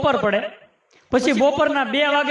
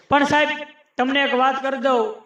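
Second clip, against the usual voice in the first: ordinary delivery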